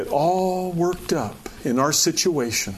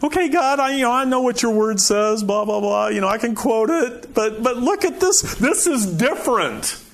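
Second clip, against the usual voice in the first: mock arguing with God; 7 semitones higher than usual. Preacher imitates arguing with God.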